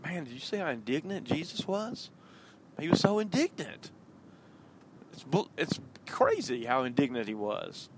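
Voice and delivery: high, loud, gossipy mock disciple; mock gossiping disciple. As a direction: play a disciple gasping over the gossip. Imagined disciples joking about Jesus.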